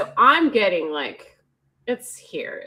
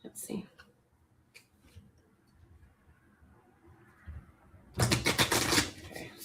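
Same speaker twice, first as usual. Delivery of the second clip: soft, hushed, near-whispered murmur; quiet ASMR-style tarot murmur; 14 dB quieter than usual. Real, not acted. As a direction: murmur to yourself like an ASMR video. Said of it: "Honey, that was A S M R".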